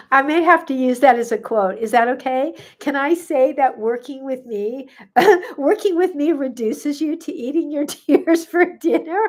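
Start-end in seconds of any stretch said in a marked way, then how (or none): 5.2-9.2 words broken by real laughs; laughing through words. Real, not acted. Host repeating the joke to viewers, laughing through it. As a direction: giggle as you say it.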